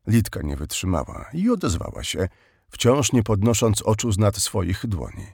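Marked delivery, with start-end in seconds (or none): none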